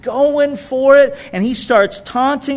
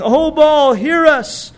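raised, higher cry to a god; calling out; 7 semitones higher, 4 dB louder, slower than usual. Acted. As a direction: call out to Baal, pleading. Prophets crying out to Baal.

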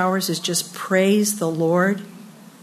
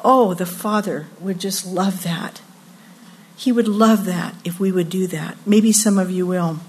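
normal talking voice

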